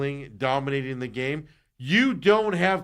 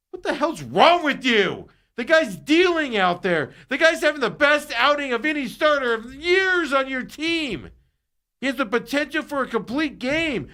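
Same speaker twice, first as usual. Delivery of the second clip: angry rant; 11 semitones higher, 4 dB louder than usual. Real, not acted. Radio host ranting about the manager.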